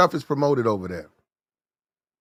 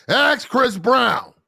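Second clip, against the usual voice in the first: high, slow snapping comeback; yelled retort; 7 semitones higher, much slower than usual. Shown as a demonstration. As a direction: throw it back in her face. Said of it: "You yell at her".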